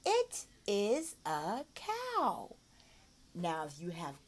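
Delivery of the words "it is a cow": "it is a cow" very slowly; drawn-out modelling; slow model. Shown as a demonstration. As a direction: stretch each word for the learner. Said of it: "model sentence".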